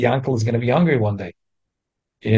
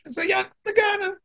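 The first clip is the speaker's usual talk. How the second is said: high-pitched mock exclamation, much higher; mocking the thief; 12 semitones higher, faster than usual. Teacher mocking the thief who shrugs off his stealing, high mock cry. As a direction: scoff at him as Yankel the thief in a raised voice.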